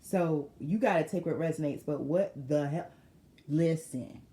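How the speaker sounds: normal talking voice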